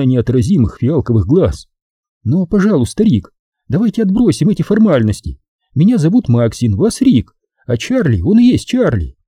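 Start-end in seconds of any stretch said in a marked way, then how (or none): 2.2-9.2 high-pitched woman dropping formalities; coaxing high female voice; Maxine, friendly and informal. Acted. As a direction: invite first names, pitched high.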